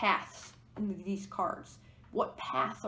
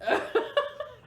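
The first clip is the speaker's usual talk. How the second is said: squeaky burst of laughter; high giggle; 6 dB louder than usual. Real, not acted. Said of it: "I'm losing it".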